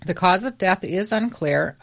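ordinary delivery

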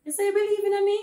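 high, incredulous retort; mock disbelief. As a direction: throw it back at him, pitched high in disbelief. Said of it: "I say".

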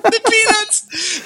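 high, slow mocking meme delivery; drawn-out high mock voice. Meme quote in put-on voice.

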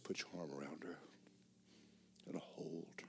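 soft whisper of God's voice; whispered command. Retold or imitated. Preacher recalling God telling him to hold the girl, whispered. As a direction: breathe it like a gentle instruction. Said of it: "and God said".

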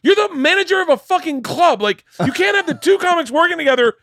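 high, loud, exasperated rant; incredulous protest. Retold or imitated. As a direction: protest in disbelief. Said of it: "I remember going".

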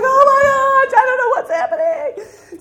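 hammed-up frantic overwhelm; mock panic. Wife retelling her computer meltdown in mock panic. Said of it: "I'm like".

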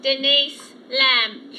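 very slow, hypnotised name; dazed monotone. Acted. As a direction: say your name as if in a trance. Dazed woman repeats her name.

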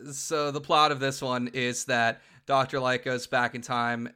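normal talking voice

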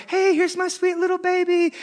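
high, cooing proud parent voice; doting parent. Acted. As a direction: show off your sweet baby in a high voice. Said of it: "They're like".

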